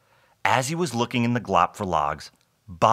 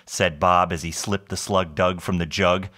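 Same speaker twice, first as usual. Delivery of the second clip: usual speaking voice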